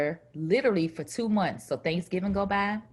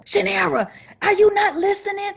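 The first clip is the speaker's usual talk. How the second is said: high excited phone call; high, loud bragging; excited cousin; 11 semitones higher, 4 dB louder than usual. Retold or imitated. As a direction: rattle it off like the thrilled cousin.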